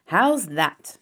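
a touch of triumph; triumphant. A narrator's reading. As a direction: proudly show off the find. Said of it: "he said triumphantly".

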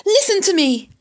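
high, loud demand; urgent cry. A narrator's reading. Wilbur begs the rat to listen, urgent cry. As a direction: cry out to be heard. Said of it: "cried Wilbur".

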